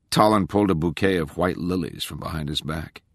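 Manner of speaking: plain reading voice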